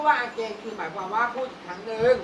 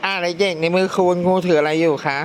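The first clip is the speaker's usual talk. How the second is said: loud classroom voice; 3 semitones lower, 5 dB louder than usual. Real, not acted. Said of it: "teacher addressing the class".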